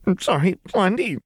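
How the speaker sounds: high, tearful apology; sobbing. A narrator's reading. Jinx apologising to Lux after crushing the glass, sobbing. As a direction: sob the apology out. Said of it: "Jinx sobbed".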